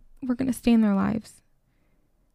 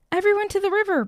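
high, urgent rallying call, only slightly louder; rally the herd with a pitched-up call; restrained storybook bellow; 8 semitones higher, 3 dB louder, faster than usual. A narrator's reading. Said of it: "bellowed Rodney".

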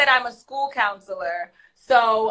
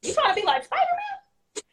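probing counselor; 12 semitones higher, 4 dB quieter than usual. Retold or imitated. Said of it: "So I said".

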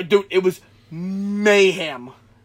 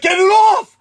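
loud, high panicked yell; retold cry of alarm; freaking out; 14 semitones higher, 10 dB louder than usual. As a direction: beg for help in a mock shriek. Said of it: "he's freaking out".

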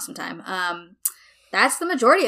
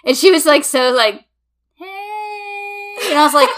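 louder, higher, breathless with excitement; excited retelling; 13 semitones higher, 8 dB louder than usual. Real, not acted. To friends, reliving the surprise, excited and loud. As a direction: tell it all fired up.

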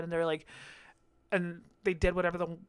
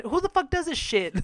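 incredulous, higher rant; can't believe anyone does this; exasperated; 8 semitones higher than usual. Real, not acted.

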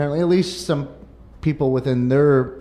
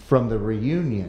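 "from the reunion" dropped lower after a long pause; delayed, lower finish on "from the reunion"; dramatic pause; 5 semitones lower, 5 dB quieter than usual. Pretend. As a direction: land "from the reunion" low as a slogan payoff. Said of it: "The pause was good".

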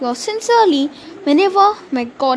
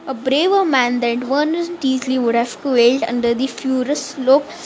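plain reading voice

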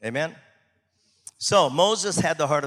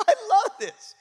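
high laughing delight; 5 dB louder than usual. Real, not acted. Pastor delighting in the story, laughing. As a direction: laugh through the words.

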